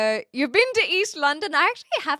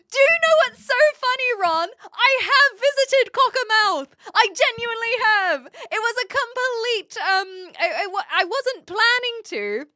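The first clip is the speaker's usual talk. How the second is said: higher and louder, bubbling over; can't believe the coincidence, with a laugh; excited, laughing disbelief; 10 semitones higher, 6 dB louder than usual. Real, not acted. Excited about visiting Cockermouth.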